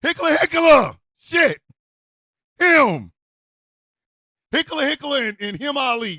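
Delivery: shouting at a tongue-twister name; frustrated shout. Real, not acted. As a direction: yell it out in frustration.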